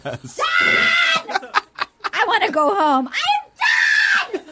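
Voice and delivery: high, drawn-out hammed-up shouting; mock tantrum. Re-enacting him as he leaves, mock tantrum shout. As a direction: wail it like an exaggerated meltdown. Acting his storming-out tantrum.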